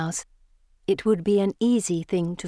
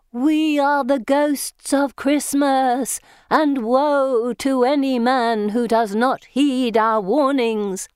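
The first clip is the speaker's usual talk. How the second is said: slow, high, louder spectral wail; ghostly wail; 6 semitones higher, 5 dB louder, much slower than usual. Acted. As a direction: wail it like a haunting spirit. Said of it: "I wail".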